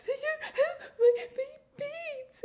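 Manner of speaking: high slow sobbing wail to the husband. Retold or imitated. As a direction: bawl it out to the husband. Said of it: "I'm crying hysterically".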